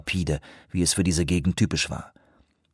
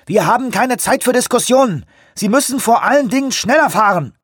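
high, loud shout into the radio; shouting into walkie talkie; 13 semitones higher, 4 dB louder than usual. A narrator's reading. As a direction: yell it into the handset over the waves. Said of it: "schrie ins Funkgerät".

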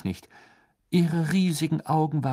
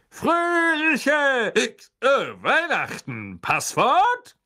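loud, high, hearty; tipsy old knight bellowing; booming knight; 13 semitones higher, 5 dB louder, much slower than usual. A narrator's reading. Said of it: "dröhnte Sir Cadogan". (dröhnend)

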